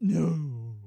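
loud, drawn-out theatrical denial; hammed-up stagey host; affected staff voice. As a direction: draw the word out grandly. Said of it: "they speak like this".

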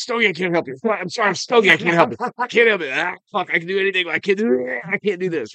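mock Jacob losing it while cleaning the truck; imitating panicking Jacob. Retelling how Jacob freaked out on the phone, hammed-up high panicked wail. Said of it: "He is carrying on like you would not fucking believe".

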